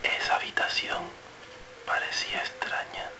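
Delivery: eerie, breath-only whisper; susurro. Shown as a demonstration. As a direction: whisper it with a pause in the middle. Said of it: "una frase cada uno en un tono diferente". (susurrado, con misterio)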